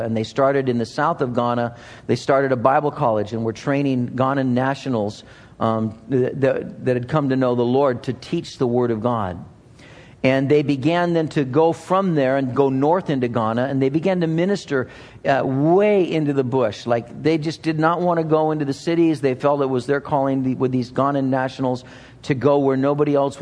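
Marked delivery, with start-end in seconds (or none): none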